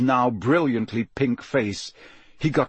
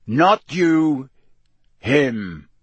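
growled correction, louder at the start; 2 semitones higher, 5 dB louder, much slower than usual. A narrator's reading. Moody correcting Harry about Crabbe, growling. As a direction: growl it, dropping on the last word. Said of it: "Moody growled".

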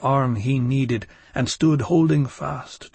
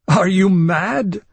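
sinister laugh; 6 semitones higher, 7 dB louder, slower than usual. Acted. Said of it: "sinister laughter erupted from my lips".

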